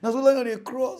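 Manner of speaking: normal talking voice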